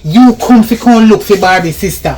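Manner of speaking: angry scolding. Acted. Helper scolds the stranger at the door, loud and angry.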